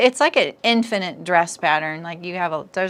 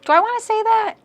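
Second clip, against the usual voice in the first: high unsure; 5 dB louder, faster than usual. Real, not acted. Second-guessing her own wording.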